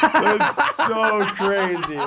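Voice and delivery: slow laughing disbelief. Real, not acted. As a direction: stretch it out laughing. Laughing reaction.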